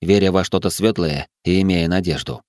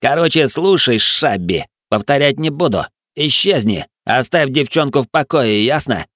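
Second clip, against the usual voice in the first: aggressive, higher-pitched hostile warning; menacing thug; 6 semitones higher than usual. Acted. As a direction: warn off a rival, pressing every demand. Hank threatens Grey. (агрессивная угроза повышенным тоном)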